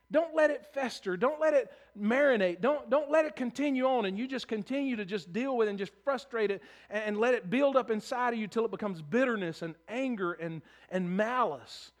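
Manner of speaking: full-throated revival preaching; preaching at full force. Acted. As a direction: drive it home to the congregation.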